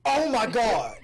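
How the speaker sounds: loud, high gasp of disbelief; hammed-up oh-my-God outburst; mock shock. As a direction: exclaim it big and scandalized. Playful shocked reaction to mamas remark.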